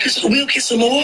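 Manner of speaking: high, hopeful wife voice; imitating wife. Wife asking her broke husband, pitched high. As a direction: ask it like a naive hopeful wife. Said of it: "She was like".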